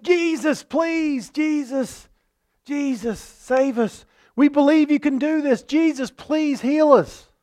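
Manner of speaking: pleading. Acted. Lepers pleading, voiced in sermon.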